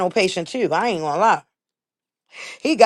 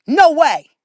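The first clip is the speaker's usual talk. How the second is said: emphatic no; 8 semitones higher, 5 dB louder than usual. Real, not acted. Emphatic refusal about the platform.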